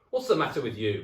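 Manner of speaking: loud, high huff; loud grumpy old man; gruff demand. Acted. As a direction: bark at her in the dark. Husband feigning concern in bed.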